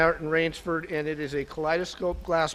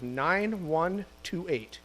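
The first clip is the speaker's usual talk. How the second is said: slow number calling; 4 dB quieter, slower than usual. Real, not acted. Calling raffle number slowly.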